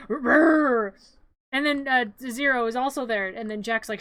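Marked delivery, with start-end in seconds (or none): 0.1-0.9 loud drawn-out growling noise; imitated growl. Playing Jack as he arrives, mock growl.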